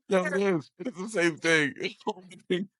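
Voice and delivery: high, helpless laugh through the words; real laughter breaking up the speech; laughing hard. Real, not acted. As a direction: talk while cracking up. Cracking up at the comparison.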